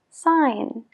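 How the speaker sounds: "Sign" said sain, no G; silent g. Shown as a demonstration. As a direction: drop the G in "Sign". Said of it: "pero no la pronunciamos". ("Sign" sin la G, sain)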